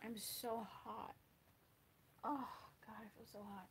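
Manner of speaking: very soft, to herself; hushed muttering. Real, not acted. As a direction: mutter it under your breath.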